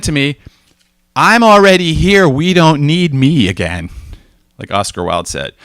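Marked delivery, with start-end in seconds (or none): 1.1-3.9 retold coworker. Coworker's quote, voiced higher, louder.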